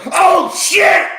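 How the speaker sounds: high, loud shocked cry; shocked shout. Retold or imitated. Pharmacy clerk shrieking when she sees the welts. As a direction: yelp at the sight of the stings.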